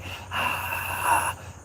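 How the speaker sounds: long voiceless breathy exhale; raspy breath. Shown as a demonstration. Showing how others describe her voice, long raspy exhale. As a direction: let out a long, raspy whispered sigh. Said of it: "it's almost like a raspy".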